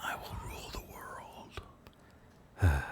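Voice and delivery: a hint of a scheming villain; menacing megalomaniac rant; evil voice. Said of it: "That was my evil voice".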